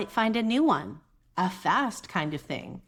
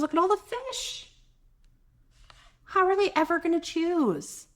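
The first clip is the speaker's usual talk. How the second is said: high amazed exclaim; 9 semitones higher than usual. Real, not acted. Reader marvels to kids.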